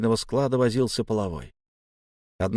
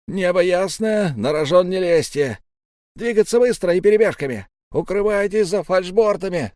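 high, loud battle briefing; rally the squad before the charge; urgent commander's call; shouted orders; 7 semitones higher, 5 dB louder than usual. A narrator's reading.

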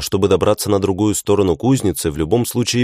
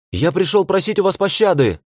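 pleading call; 12 semitones higher than usual. A narrator's reading. Hero begging orcs.

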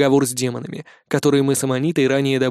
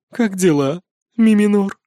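smirking; 5 semitones higher, 3 dB louder than usual. A narrator's reading. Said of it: "с усмешкой произнёс".

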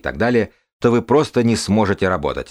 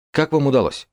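journalist voice; 7 semitones higher than usual. Acted. Journalist asks the winner.

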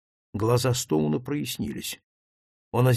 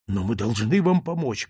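ordinary delivery

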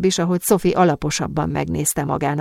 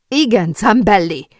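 raised, loud demand; shouting; 4 semitones higher, 6 dB louder than usual. A narrator's reading. Sophie joins Ellen against Zambelli, shouting. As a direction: back the protest up loudly and angrily. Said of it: "kiabált Sophie".

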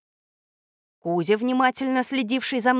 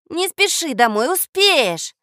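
eager, higher-pitched plea; pleading; 7 semitones higher, 6 dB louder, slower than usual. A narrator's reading. Boy asks his friend to stay longer, pleading. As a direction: ask keenly for a little longer. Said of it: "попросил я".

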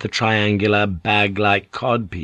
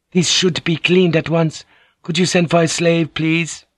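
a higher, courteous woman's voice; polite lady; 8 semitones higher than usual. Acted. Mariko asking the boatswain about laundry, higher polite voice. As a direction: ask it gently and properly, a higher lady's voice.

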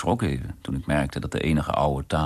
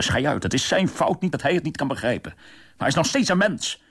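angry shout; 8 semitones higher than usual. A narrator's reading. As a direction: snap at the whole room in fury. (woedend uitroepen tegen de lachende klanten)

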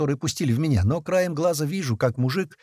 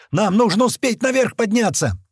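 high, raised voice; rallying shout; 8 semitones higher, 4 dB louder than usual. A narrator's reading. Urging workers to get upstairs, shouting. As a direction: shout to hurry the crowd along.

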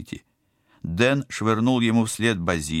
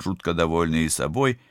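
plain reading voice

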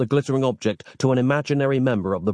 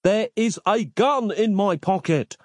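furious; 10 semitones higher than usual. A narrator's reading. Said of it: "furious now".

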